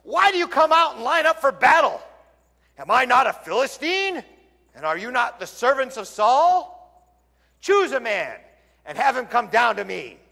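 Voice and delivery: loud, booming challenge to the ranks; Goliath shouting. A narrator's reading. Preacher voicing Goliath shouting at Israel's army, loud. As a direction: bellow it across the battlefield. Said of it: "Goliath stood and shouted".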